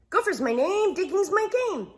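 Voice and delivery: high, fast, eager little gopher. Acted. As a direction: pop up keen and quick. Gopher's catchphrase.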